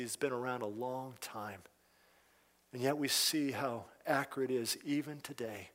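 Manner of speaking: hushed pastoral prayer; closing prayer. Acted. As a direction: pray quietly over the congregation.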